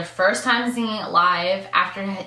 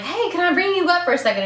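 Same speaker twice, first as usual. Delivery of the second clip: high, loud, quick hype-man voice; upbeat DJ; 11 semitones higher, 6 dB louder, faster than usual. Retold or imitated. DJ calling a fan onstage, high and loud. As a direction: call her up like a hyped DJ. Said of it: "the D J was like".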